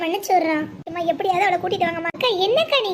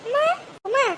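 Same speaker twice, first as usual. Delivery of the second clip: higher-pitched, anxious calls; alarmed calling; 5 semitones higher than usual. Acted. Woman calling to a fainted mother to get up, alarmed. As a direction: try urgently to rouse her.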